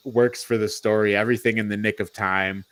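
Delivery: normal talking voice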